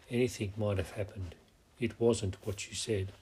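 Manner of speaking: ordinary delivery, nothing marked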